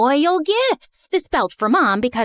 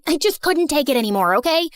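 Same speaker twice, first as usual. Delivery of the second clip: ordinary delivery, nothing marked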